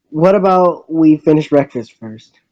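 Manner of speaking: ordinary delivery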